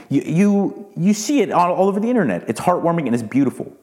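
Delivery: ordinary talk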